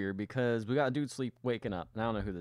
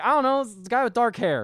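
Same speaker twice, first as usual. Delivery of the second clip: much higher, louder, playing dumb; mock clueless; 10 semitones higher, 4 dB louder, faster than usual. Host mimics her own confusion to her co-host, mock clueless. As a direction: act baffled by two dark-haired guys. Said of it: "I was like".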